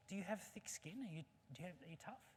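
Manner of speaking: soft, halting monkey questions; questioning monkey. Acted. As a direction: ask the squirrel tentatively, starting high. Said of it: "The monkey says".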